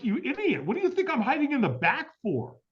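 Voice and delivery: high-pitched, exasperated comeback; indignant retort. Retold or imitated. Man hiding at the back answering a stranger, indignant. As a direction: protest as if the question is absurd.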